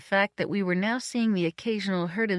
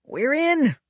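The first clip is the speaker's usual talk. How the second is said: high, loud burst of triumph; gleeful exclamation; 9 semitones higher, 5 dB louder than usual. A narrator's reading. Lewis to his companion at the gate, gleeful. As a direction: exclaim it with delight, pitch leaping up.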